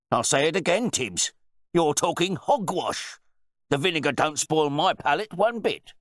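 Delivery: loud, high-pitched outrage; blustering, affronted wine bore; indignant host. A narrator's reading. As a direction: challenge the butler in disbelief. Host insists to butler.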